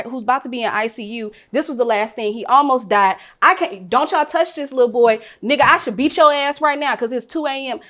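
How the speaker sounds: half-shouted demand, fierce and protective; retold angry plea. Warning police about the boy who stole her car, heated. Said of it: "all I keep saying to the police".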